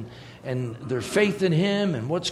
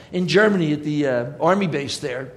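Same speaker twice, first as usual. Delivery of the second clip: ordinary talk, nothing marked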